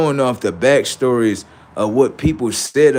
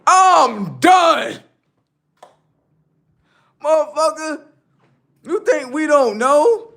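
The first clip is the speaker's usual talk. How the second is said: high heated rant; 13 semitones higher, 3 dB louder than usual. Real, not acted. Gamer ranting at the NPC, high and indignant. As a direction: pitch jumps way up, loud and indignant.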